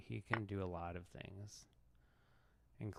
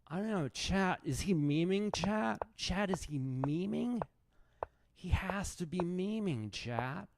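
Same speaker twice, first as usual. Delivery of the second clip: high, loud exasperated streamer voice; high-pitched, louder outraged chess streamer; Hikaru impression; 7 semitones higher, 5 dB louder than usual. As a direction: rant to chat in a squeaky mock outrage. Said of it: "this guy doesn't sound anything like me".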